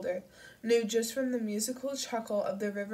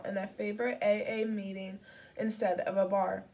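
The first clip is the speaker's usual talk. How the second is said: plain reading voice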